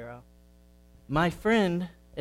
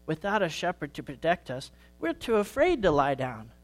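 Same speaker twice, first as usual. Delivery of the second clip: higher, gentle storytelling granny voice; wise old grandma sheep; 6 semitones higher than usual. Acted. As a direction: explain patiently like the oldest ewe. Grandma sheep explains to Sammy.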